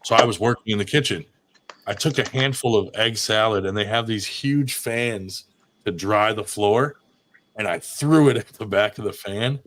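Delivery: ordinary talk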